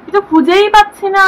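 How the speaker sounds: wailed in exasperation; exasperated complaint. Real, not acted. Frustrated at finding nothing.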